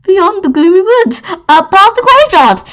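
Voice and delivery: high, loud wail of alarm; alarmed mayor. Acted. Mayor frightened by where the monsters live, alarmed cry. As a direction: cry it out as a dire warning.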